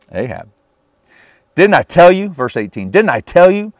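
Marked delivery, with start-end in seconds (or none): 1.6-2.3 high, loud indignant I-told-you-so; acted exasperated outburst, pitched up; exclaiming. A narrator's reading. King Ahab complaining to Jehoshaphat about the prophet, exclaiming. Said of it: "he exclaimed to Jehoshaphat". 2.9-3.7 high, loud indignant I-told-you-so; acted exasperated outburst, pitched up; exclaiming. A narrator's reading. King Ahab complaining to Jehoshaphat about the prophet, exclaiming. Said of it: "he exclaimed to Jehoshaphat".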